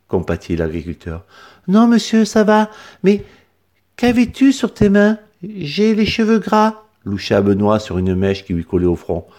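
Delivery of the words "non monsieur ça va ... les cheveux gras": voix d'enfant bien plus aiguée; un petit garçon poli qui répond. A narrator's reading. Benoît, l'enfant coincé. (enfant à la voix très aiguë)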